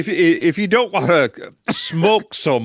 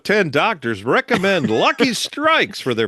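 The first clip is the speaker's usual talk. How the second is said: chipper 1950s ad; 6 semitones higher, 4 dB louder than usual. Retold or imitated. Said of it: "vintage ads".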